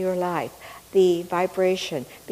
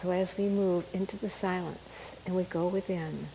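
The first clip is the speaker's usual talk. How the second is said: guided meditation; 7 dB quieter than usual. Acted. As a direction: ease them gently into calm. Meditation prayer into silence.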